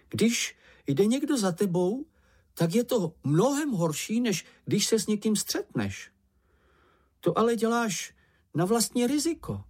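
high-pitched worrier's voice; timid, anxious Čenich. Acted. Čenich warns Mumínek about being followed, anxious high voice. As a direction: warn fretfully in a small high voice.

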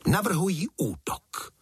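very high-pitched acted call to action; sudden exclamation. A narrator's reading. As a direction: burst out with a rallying proposal. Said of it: "zvolala náhle Václavka".

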